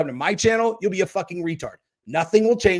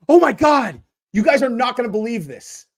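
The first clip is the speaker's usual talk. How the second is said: much higher, louder gasp of disbelief; mock astonishment; 7 semitones higher, 6 dB louder than usual. Real, not acted. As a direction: act floored by the news. Reacts to the troll's return.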